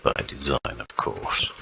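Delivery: ordinary talk, nothing marked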